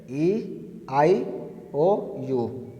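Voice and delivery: each vowel said slowly and softly, with gaps; name the vowels one by one, slowly and quietly; slow listing of vowels. Shown as a demonstration. Teacher listing English vowels for the class, slow and soft. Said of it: "वो पांच vowel कौन कौन है".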